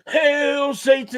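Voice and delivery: high, drawn-out mock metalhead yell; mock fanatical shout. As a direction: bellow it slowly like a crazed concert fan. Imitating a wild sweaty concert fan.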